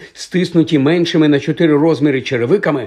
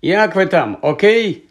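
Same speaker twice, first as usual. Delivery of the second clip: high-pitched, raised call; 6 semitones higher, 3 dB louder than usual. A narrator's reading. Transformed Harry calls to friends in other cabins, shouting. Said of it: "Він зняв їх і крикнув".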